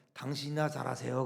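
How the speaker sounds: low, deadpan quoted retort; famous-line delivery. Acted. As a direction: drop low for a dry put-down. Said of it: "그 유명한 말이 있잖아요".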